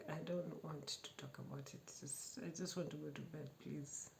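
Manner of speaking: quiet, quick and curt, shutting him out; a hushed, sulky refusal to talk; cold, fake-angry brush-off. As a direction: mutter it fast like you're still furious. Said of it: "and I'm just like".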